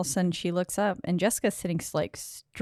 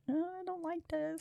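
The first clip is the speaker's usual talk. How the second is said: much higher, hushed possessed voice; distorted possessed voice; 12 semitones higher, 11 dB quieter than usual. Retold or imitated. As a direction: imitate an eerie distorted voice, high and soft. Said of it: "her voice starts distorting too".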